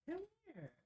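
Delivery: high sing-song call to the dog. Real, not acted. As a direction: coax the puppy over, way up high. Calling the dog over.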